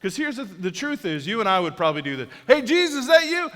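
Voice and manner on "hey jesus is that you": high, loud shout across the water; calling out. Retold or imitated. Peter shouting to Jesus across the water, loud. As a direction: call out to someone far away.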